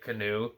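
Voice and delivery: Canu said as kuh-NOO; alternative pronunciation. Shown as a demonstration. Movie fan questioning the actor's name, Canu pronounced kuh-NOO. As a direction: shorten it to two syllables, Canu as kuh-NOO. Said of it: "I've heard different pronunciations".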